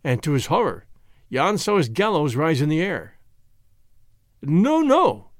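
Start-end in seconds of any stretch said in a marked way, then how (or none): 4.4-5.3 high, alarmed refusal; horrified high protest; crying out in protest. A narrator's reading. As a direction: cry out against it, pitch leaping up. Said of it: "he cried".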